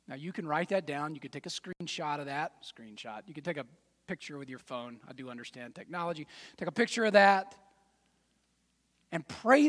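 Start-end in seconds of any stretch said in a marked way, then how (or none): none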